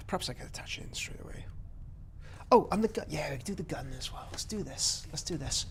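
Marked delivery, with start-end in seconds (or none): 0.1-1.4 quick whisper to himself; whispered aside. Real, not acted. Builder whispering a quick idea to himself. 3.1-5.7 quick quiet whisper; whispered aside. Real, not acted. Builder whispering plans to himself while working.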